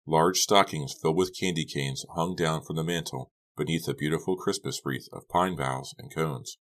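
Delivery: usual speaking voice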